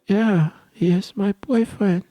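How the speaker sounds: put-on high, slow gushing girl; mock girly gush. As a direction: announce it like a proud little girl. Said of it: "she's like".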